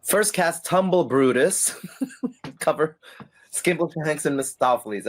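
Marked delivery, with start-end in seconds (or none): none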